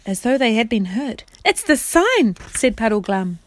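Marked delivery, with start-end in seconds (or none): 1.5-2.4 high, loud sudden recognition; startled realisation. Acted. Puddleglum to the others, high shocked recognition. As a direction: blurt it out in shock.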